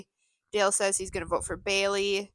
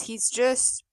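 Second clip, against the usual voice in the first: ordinary delivery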